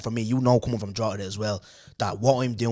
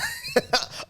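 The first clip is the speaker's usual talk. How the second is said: sudden high-pitched laugh; high burst of laughter. Real, not acted. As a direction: break into a high laugh at the memory. Recalling a funny business idea.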